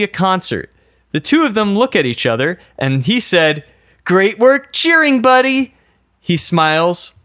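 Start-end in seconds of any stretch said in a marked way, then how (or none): 4.0-5.7 high, hammed-up congratulation; acted hearty praise. Best friend after a concert, hammed-up praise. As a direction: congratulate your buddy in a high, eager voice.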